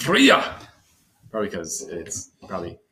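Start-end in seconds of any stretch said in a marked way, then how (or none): 0.0-0.6 high, loud mock Scottish cry; hammed-up Scottish accent, pitched way up; mimicked Scottish accent. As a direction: echo it as an exaggerated Scottish shout. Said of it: "That was a Scottish accent".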